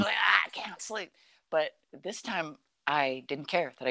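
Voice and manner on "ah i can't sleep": mock whiny complaint. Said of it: "super butthurt if I couldn't sleep".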